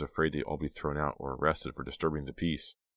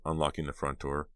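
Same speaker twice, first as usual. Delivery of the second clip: usual speaking voice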